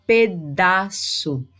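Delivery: "Pedaço" stretched into pe-da-ço; slow teacher's demo of "Pedaço"; sounded-out word. Shown as a demonstration. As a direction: say "Pedaço" very slowly so each consonant shows. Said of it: "você tem o som da consoante".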